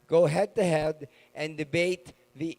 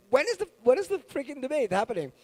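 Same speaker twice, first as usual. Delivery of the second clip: impatient fans; 9 semitones higher, faster than usual. Retold or imitated. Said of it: "People have nonstop".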